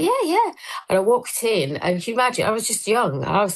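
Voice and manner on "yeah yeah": eager yes. Retold or imitated. Eager young self accepting invite.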